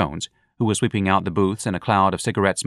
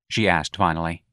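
usual speaking voice

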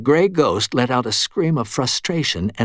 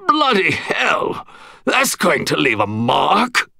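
put-on high, slow British old soldier; gruff English gentleman; 6 semitones higher, slower than usual. A narrator's reading. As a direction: grumble like a hurt old Englishman. Wounded old Englishman complaining.

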